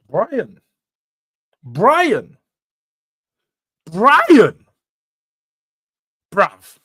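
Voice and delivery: building from a plain call to a loud shout of the name; repeated name call swelling to a shout. Real, not acted. Calling out to a chatter.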